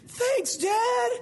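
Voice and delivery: put-on very high, delighted kid shout; excited child. Child thanking dad for the fix, excited high shout. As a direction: cry out like a thrilled little kid. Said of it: "they're really excited".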